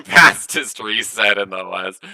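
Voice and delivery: slow, amused repeat through a laugh; laughing echo. Real, not acted. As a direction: chuckle it back. Laughing echo of friend's joke.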